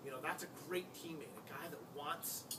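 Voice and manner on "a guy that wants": ordinary talk, nothing marked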